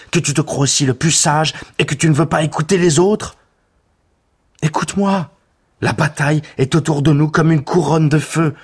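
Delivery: angry warrior pleading with his leader; furious plea. Acted. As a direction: shake him into listening, angry and urgent. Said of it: "Il était furieux".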